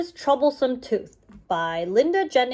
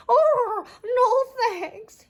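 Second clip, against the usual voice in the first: much higher, crying goat plea; sobbing; 13 semitones higher, much slower than usual. Acted. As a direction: whimper it through tears. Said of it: "he sobbed".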